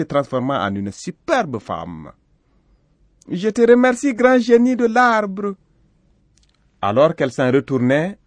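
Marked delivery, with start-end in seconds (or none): 3.3-5.5 high, loud female character voice; grateful young woman. A narrator's reading. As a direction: thank him in a high, ringing voice. Transformed goat thanks the genie.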